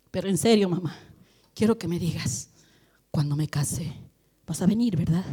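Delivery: earnest son. Retold or imitated. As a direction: ask it low and serious like a grown son. Son asking mother earnestly.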